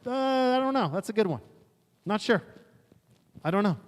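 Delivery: high, hesitant mock stumped king; stumped Solomon. Preacher imitating Solomon stumped by a hard question, high and hesitant. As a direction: play a wise king caught without an answer. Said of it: "Solomon would have been like".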